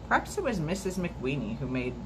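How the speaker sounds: ordinary delivery, nothing marked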